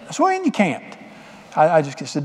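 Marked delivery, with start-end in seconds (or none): 0.2-1.0 high, startled question; a father caught off guard, pitch jumping up; surprised father. Retold or imitated. Father startled as his son refuses dinner, high and surprised.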